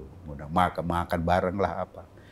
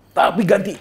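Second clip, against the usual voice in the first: imitating the president; 11 semitones higher, 8 dB louder than usual. President insists.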